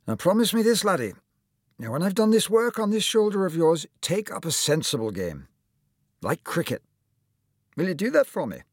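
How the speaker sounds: lightly voiced cheery doctor; kindly old surgeon. A narrator's reading. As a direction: play the jolly surgeon greeting his patient. Surgeon advises Tom.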